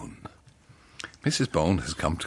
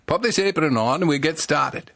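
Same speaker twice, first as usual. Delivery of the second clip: lightly done Welsh baker's wife; a hint of a Welsh lilt, pitched up; Welsh accent; 7 semitones higher, 4 dB louder than usual. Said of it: "Missus Barnaby says".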